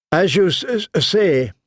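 stammering, nervous officer; stuttering. A narrator's reading. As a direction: trip over the first sounds. Smallwood stutters, sullen.

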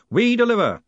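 high, loud slogan; triumphant shout to a crowd; yelled. A narrator's reading. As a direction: belt it out like a showman. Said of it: "yelled Moist".

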